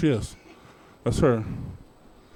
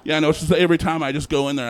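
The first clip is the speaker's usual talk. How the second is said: ordinary delivery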